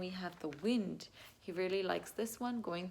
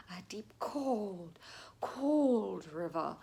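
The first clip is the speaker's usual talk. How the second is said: spooky whisper; 3 dB louder, slower than usual. Shown as a demonstration. Reading the cold river page.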